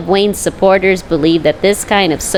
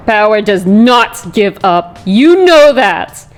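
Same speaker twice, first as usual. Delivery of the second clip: slow loud defiance; 4 dB louder than usual. Acted. Leslie on the crane, defying Batman: slow loud defiance. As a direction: drag it out, loud and bitter.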